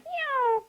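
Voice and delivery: high, loud "nyow" swooping down; loud descending zoom sound; falling whistle noise. A narrator's reading. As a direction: voice a cartoon tumble. Imitated noise of chick falling.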